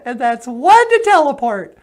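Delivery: very high, loud squeaky alien. Acted. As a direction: greet him like a tiny cartoon alien.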